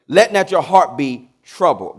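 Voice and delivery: each word laid down slowly; slow solemn quote. Shown as a demonstration. Preacher reads verse to church, slow and weighty. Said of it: "that's what the scripture said".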